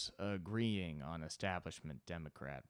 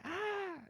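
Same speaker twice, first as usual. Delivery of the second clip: put-on high baby squeal. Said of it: "and they're like".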